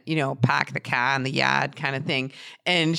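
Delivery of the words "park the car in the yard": imitated hardcore Boston accent, dropping the r's. Podcaster illustrating a woman's hardcore accent, mock Boston accent. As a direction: quote it lower, in a thick Boston accent. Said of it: "she had a hardcore Boston accent".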